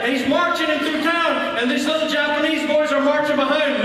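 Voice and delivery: high, exclaimed sermon storytelling; full-force preaching. A narrator's reading. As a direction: cry out the climax of the tale to a congregation.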